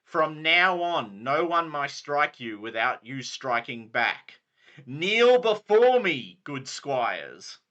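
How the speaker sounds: slow, loud commanding lord; Sir Brastius. Acted. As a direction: command them to kneel. Lord commands squires kneel.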